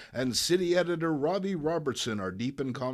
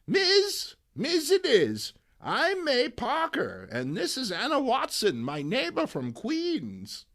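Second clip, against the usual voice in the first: put-on high, sweet old lady voice; high old lady introducing her neighbor; Aunt May voice; 11 semitones higher than usual. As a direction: chirp it like a cheerful granny.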